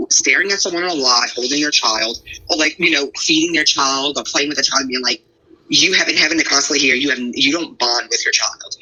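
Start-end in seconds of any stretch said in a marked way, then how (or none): none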